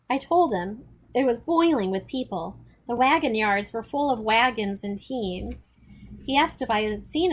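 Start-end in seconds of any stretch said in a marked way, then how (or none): none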